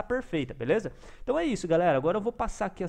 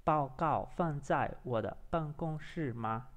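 slow, softer Mandarin with long gaps; careful drilling; 6 dB quieter, much slower than usual. Shown as a demonstration. Learner drilling the app's question, slow and careful. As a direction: feel out each syllable slowly.

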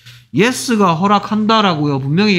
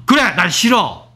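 blunt refusal; 3 semitones higher than usual. Shown as a demonstration. Teacher acts out an absurdly rude answer, blunt refusal. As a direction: flatly reject it, pitch jumping up. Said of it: "이렇게 거절하겠습니까?".

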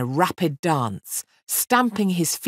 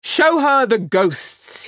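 higher, louder, slow cry; fiend's cry; 8 semitones higher, 6 dB louder, slower than usual. Acted. Fiend commanding his imps, loud slow cry. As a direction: call out grandly. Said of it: "he cried".

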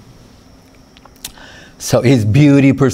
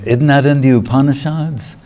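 ordinary talk

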